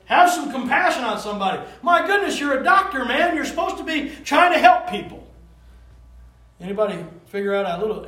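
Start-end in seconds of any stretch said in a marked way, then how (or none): 0.0-5.3 indignant scolding. Retold or imitated. Scolding the uncaring doctor.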